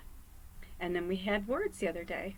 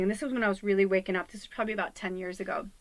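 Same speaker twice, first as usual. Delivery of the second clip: ordinary talk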